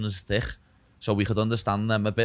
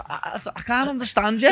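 retold helpless reply; 13 semitones higher, 3 dB louder than usual. Retold helpless reply to Scottish man.

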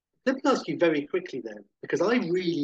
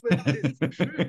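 ordinary talk

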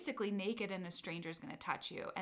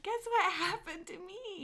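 excited gossip; 11 semitones higher, 4 dB louder than usual. Retold or imitated. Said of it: "I was like".

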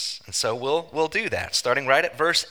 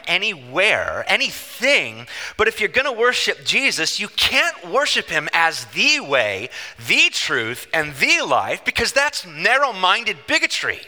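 higher, louder sarcastic build; impassioned preaching; 5 semitones higher, 5 dB louder than usual. Acted. Preacher to congregation, rising sarcastic indignation.

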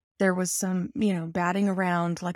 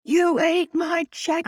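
high, loud, slow furious scream; screaming at the cat; 10 semitones higher, 5 dB louder, slower than usual. Retold or imitated. Owner screaming at the cat over the missing chick, high furious shriek. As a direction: shriek it at the cat like he ate your pet. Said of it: "I was literally screaming at the cat".

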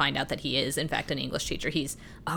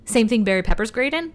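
ordinary talk

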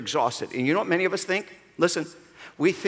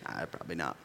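a quiet, low lazy answer; 13 semitones lower, 17 dB quieter than usual. Retold or imitated. Mock answer about God being hick.

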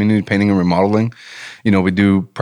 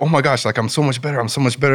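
higher-pitched excitement at no extra volume; mock excited scream; 6 semitones higher, faster than usual. Mailman retelling a healed man's shouting, mildly mock-screamed. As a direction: imitate his excited shouting, held back in volume. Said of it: "he's just screaming like".

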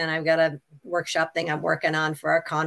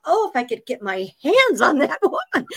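laughing mock exasperation; 9 semitones higher, 4 dB louder than usual. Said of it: "And I thought".

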